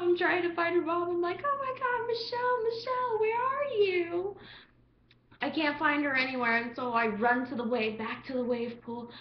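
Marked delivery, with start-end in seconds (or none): 1.4-4.4 high, frantic calling; high frantic call for friend. Retold or imitated. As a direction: search for Michelle, high and urgent.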